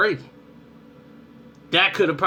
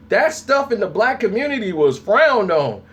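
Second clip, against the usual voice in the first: ordinary talk, nothing marked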